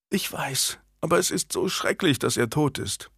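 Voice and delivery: soft, high, close to tears; tearful. A narrator's reading. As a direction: speak through grief, softly. Anne bursts into tears. (weinerlich und leise)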